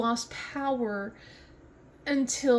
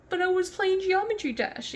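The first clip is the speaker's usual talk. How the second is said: high-pitched imitation of a kid; mock kid voice, pitched up; child's protest; 7 semitones higher than usual. Child protesting in retold quote.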